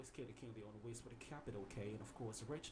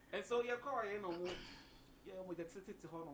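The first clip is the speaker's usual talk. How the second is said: high and loud, thrilled; sudden excited outburst in retelling; excited burst; 7 semitones higher, 6 dB louder than usual. Real, not acted.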